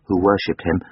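usual speaking voice

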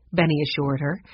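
plain reading voice

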